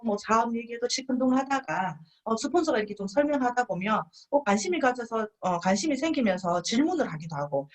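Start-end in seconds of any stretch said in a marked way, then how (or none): none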